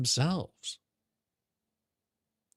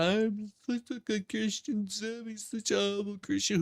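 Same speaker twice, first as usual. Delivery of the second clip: higher, softer whiny imitation of a smug rival; mocking pious voice; 7 semitones higher, 4 dB quieter than usual. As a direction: sneer it like a self-righteous churchgoer. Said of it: "talking about".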